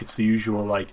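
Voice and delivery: normal talking voice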